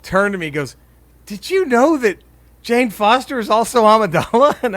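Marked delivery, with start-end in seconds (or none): 1.3-4.6 imitating a wide-eyed kid, pitched up; bright-eyed earnestness. Telling about Colton's big news from the credits, bright-eyed. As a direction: retell it as an earnest, higher-voiced discovery. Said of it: "he just bright-eyed turned to me".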